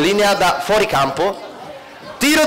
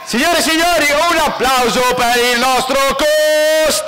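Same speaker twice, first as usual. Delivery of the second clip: announcer voice; 4 semitones higher than usual. Acted. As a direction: announce to the crowd. Announcing next contestant. (annuncio da presentatore)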